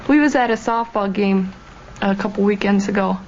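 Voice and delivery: normal talking voice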